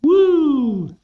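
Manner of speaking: very high whoop; party whoop. Retold or imitated. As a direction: cheer to kick off the party. Woman starting a party.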